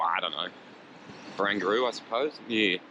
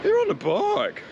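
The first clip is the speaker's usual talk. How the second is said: squeaky, much higher and louder mocking yell; mock angry motorist; 5 dB louder than usual. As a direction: screech it like a cartoon bully driver. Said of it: "And I was like".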